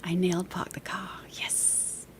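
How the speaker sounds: hushed private little victory; whispered self-congratulation. Real, not acted. Streamer pleased at nailing Park the Car, whispered. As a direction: whisper it to yourself, triumphant. Celebrates her accent under breath.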